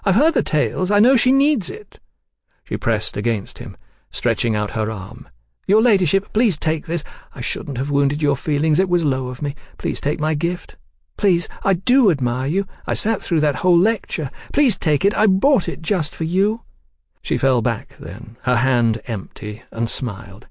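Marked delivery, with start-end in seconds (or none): 0.0-2.0 insistent pleading. Acted. 5.7-16.6 begging. Acted. Woman begs Lady Ada.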